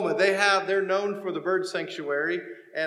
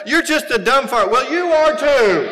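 mock spousal spat; 5 semitones higher, 12 dB louder, slower than usual. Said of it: "the fights that they had".

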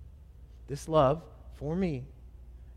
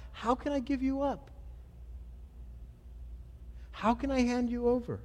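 restrained crying out; 9 semitones higher than usual. Retold or imitated. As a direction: plead gently in a raised pitch, drawing back in volume. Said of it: "The Father never cried out".

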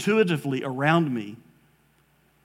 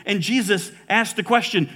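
normal talking voice

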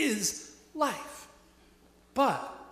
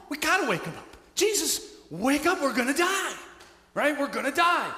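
higher, louder, urgent pleading to wake him; panicked disciples; 7 semitones higher, 3 dB louder than usual. Acted. Frightened disciples waking Jesus in the storm, panicked plea. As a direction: shake him awake, we're sinking.

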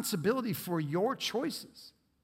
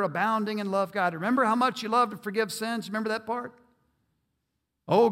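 gushing, buttering-up prayer, louder and higher; flattering prayer; 4 semitones higher, 4 dB louder than usual. Retold or imitated. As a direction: lay the praise on thick to win favor. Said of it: "this is what they're doing".

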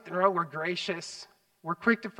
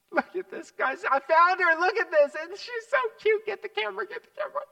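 much higher, louder delighted squeal; rising higher and higher with joy; giddy excitement; 13 semitones higher, 6 dB louder than usual. Retold or imitated. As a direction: call everyone over, thrilled. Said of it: "I'm just like".

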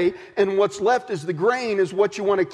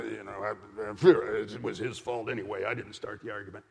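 low, soft mock excuse; sulky mumble; 11 semitones lower, 7 dB quieter than usual. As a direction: grumble it like a caught child. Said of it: "the disciples are all going".

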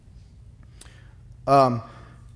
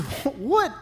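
much higher, startled yelp of a question; startled what; 14 semitones higher than usual. Retold or imitated. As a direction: react as if just whacked on the shin. Hit on the shin by cane.

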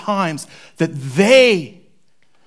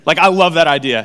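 usual speaking voice

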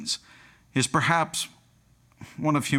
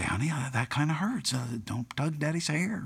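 soft, patient dad voice; gentle; 3 semitones higher, 7 dB quieter than usual. Retold or imitated. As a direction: softly plead with a little child. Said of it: "very gently say".

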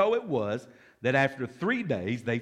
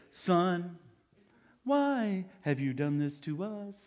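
higher, slow, put-on mother's reproach; scolding mother; 4 semitones higher, slower than usual. Shown as a demonstration. Mary reproaching her lost son, slow mock scolding. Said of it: "Do you think it sounded like I said it?".

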